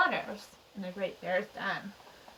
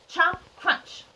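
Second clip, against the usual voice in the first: plain reading voice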